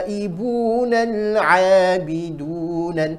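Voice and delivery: slow recited At-taibunal abidunal; slow melodic tilawah; correct recitation. Shown as a demonstration. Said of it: "Ya, betul seperti itu".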